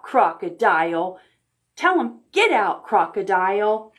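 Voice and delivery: ordinary talk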